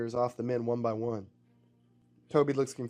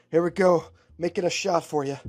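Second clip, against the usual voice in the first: loud, higher call in a gunfight; raised, punchy action-hero shout; 5 semitones higher, 7 dB louder than usual. Acted. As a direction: call it out to a partner mid-fight. Character calls out during gunfight.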